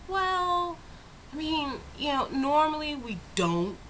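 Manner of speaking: high, drawn-out "well" of polite doubt; reluctant hedging. Retold or imitated. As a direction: imitate hemming and hawing over a favor. Retold reluctant reply to customer.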